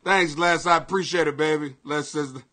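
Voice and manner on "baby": usual speaking voice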